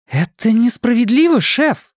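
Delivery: high indignant outburst; flaring up. A narrator's reading. Anna protesting to the chief, indignant outburst. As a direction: protest hotly at the unfairness. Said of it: "вспыхнула она".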